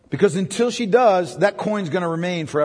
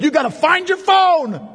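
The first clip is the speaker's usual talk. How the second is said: mock frantic shout; 7 semitones higher, slower than usual. Hammed-up urgency over lost phone.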